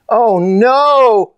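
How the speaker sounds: loud, high-pitched wail; dismayed shout. A narrator's reading. Girls learn their kitten is missing, shouting in dismay. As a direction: cry out in alarm, holding it high. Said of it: "shouted the girls in unison".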